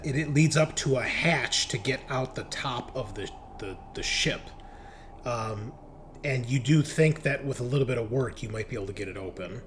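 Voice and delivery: ordinary talk, nothing marked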